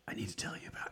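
imitating a breathy hushed whisper, barely audible. Husband whispering to Lisey, hushed imitated whisper. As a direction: mimic the mumbled whisper you strain to hear. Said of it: "hushed whispers when he's talking to Lisey".

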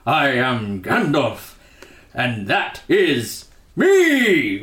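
hugely drawn-out grand wizard proclamation; slow, hammed-up announcement of oneself; Gandalf. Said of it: "Gandalf shows up and he goes".

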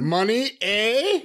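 mock "Money, eh" with a lifted ending; mocking mispronunciation. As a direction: imitate a bad French "Money, eh" as a teasing question. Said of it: "sorry if my accent is off".

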